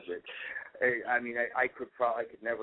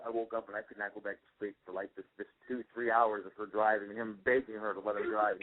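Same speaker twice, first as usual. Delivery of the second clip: ordinary talk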